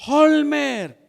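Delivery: high, drawn-out call of a name. Acted. Boys at his window summoning the young preacher, calling out.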